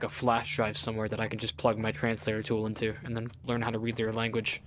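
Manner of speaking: normal talking voice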